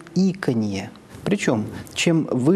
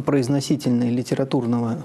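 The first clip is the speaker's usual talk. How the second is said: normal talking voice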